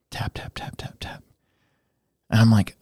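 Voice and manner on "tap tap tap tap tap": whispered tap tap, very soft, imitating a timid knock; soft knock sound. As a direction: breathe the taps out almost silently. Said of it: "there's a very soft knock on the door".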